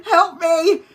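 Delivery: high, pleading cry for help; hammed-up high plea; mock plea. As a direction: squeak it like a terrified man. Brother pleading, scared of girlfriend.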